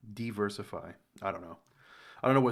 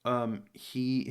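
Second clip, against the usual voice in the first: normal talking voice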